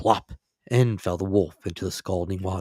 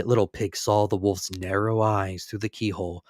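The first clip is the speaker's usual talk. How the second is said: usual speaking voice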